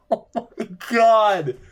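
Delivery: laughing disbelief. Real, not acted. Laughing at own sarcasm.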